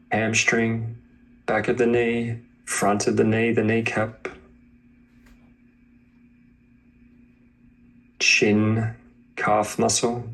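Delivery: slow, even meditation voice; name each body part evenly, pausing to let it land; guided body scan. Acted. Teacher calling out body parts to a student, meditation voice.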